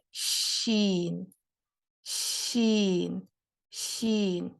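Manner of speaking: شین stretched very slowly with breath filling the mouth; spread shin. Shown as a demonstration. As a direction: let the air spread through the whole mouth on شین. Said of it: "ہم اس ش کو کہیں گے تفشی". (شین کو سانس پھیلا کر لمبا ادا کرنا)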